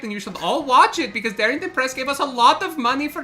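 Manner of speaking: high, bubbly cartoonish voice; chirpy villain doing a sales pitch; Jester impression. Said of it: "I am the evil A I version of Jester".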